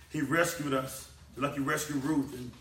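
ordinary talk

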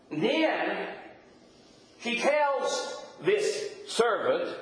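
ordinary talk, nothing marked

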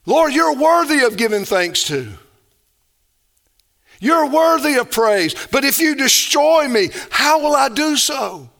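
high, loud impassioned plea to God; preacher pleading loudly on David's behalf; fervent preaching. Acted. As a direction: cry it out to the Lord with full force. Preaching the psalm at full force.

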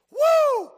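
high loud preacher's whoop; exultant whoop. Real, not acted. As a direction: let out a joyful holler.

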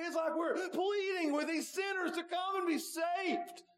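high, impassioned pleading sermon voice; full-force preaching. Acted. Preacher urging the whole congregation to come, impassioned. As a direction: plead with the sinners, voice soaring high.

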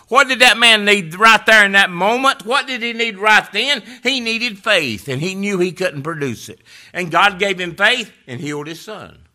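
loud, high, driving sermon cry; preaching at full force. Acted. Pastor pressing the congregation toward faith, loud preaching. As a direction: lift the whole room.

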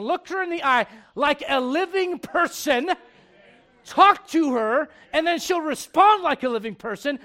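loud, emphatic preacher building to a climax; preaching at full force. Acted. Preacher urging young men to talk face to face, loud and fervent.

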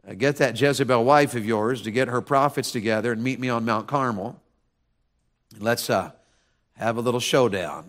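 low, soft, understated dare; cool challenge. Acted. Elijah invites Ahab to a showdown, cool and casual. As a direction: quietly throw down the challenge.

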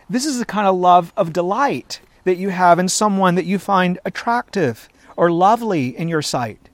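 ordinary talk, nothing marked

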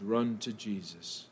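hushed, slow "run to Jesus"; quiet slowed invitation; soft slow appeal. Acted. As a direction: lean in and plead gently.

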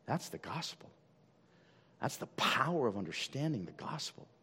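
hushed preaching. Acted. Hushed climax of sermon.

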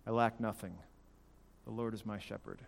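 low, soft, settled; hushed reassurance after an outburst; calming self-reminder. Retold or imitated. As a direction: talk yourself down quietly. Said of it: "and reminding myself".

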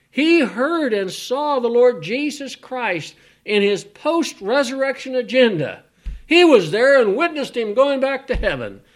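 high, loud, rising sermon cadence; preaching at full force. Acted. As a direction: preach it up to the climax.

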